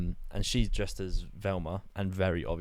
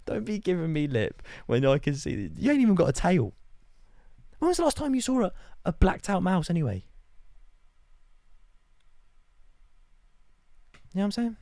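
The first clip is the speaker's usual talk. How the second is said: high, louder mock-tough telling off; mock heated rant; 11 semitones higher, 5 dB louder than usual. Retelling how he got heated at the mouse girl, high and loud. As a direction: give her lip back, hammed-up. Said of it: "I was like Listen".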